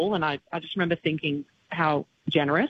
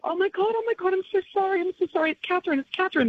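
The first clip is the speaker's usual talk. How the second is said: much higher, fast and gushing sorry; flustered Dolly apology; 14 semitones higher, faster than usual. Retold or imitated. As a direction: gush apologies in a high flustered rush. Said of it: "she kept going on about it".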